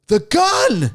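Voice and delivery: high, loud outburst; high, loud eureka cry; sudden exclamation. Retold or imitated. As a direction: blurt it like a brainstorm. Comic's panic, pulls gun.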